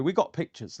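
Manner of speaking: ordinary delivery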